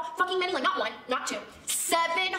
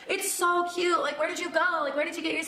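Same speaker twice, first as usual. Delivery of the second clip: put-on higher, girly gushing friends; 5 semitones higher than usual. Friends admire her new earrings, high and gushing. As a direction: play the excited schoolfriends cooing. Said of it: "all my friends are like".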